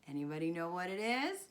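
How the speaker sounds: usual speaking voice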